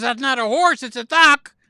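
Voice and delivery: loud, high, indignant wife; acted outburst, pitched high and loud; exasperated correction. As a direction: correct him at full volume. Wife's correcting line retold.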